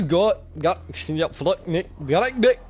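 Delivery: high, loud mock Scottish; Scottish accent. Shown as a demonstration. Player showing off his accents to co-host, mock Scottish. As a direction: bark out a caricature Scottish accent in bursts. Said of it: "Here's Scottish".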